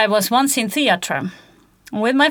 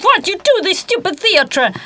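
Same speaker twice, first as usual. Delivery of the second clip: mock outrage; 8 semitones higher than usual. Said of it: "very angry and thought".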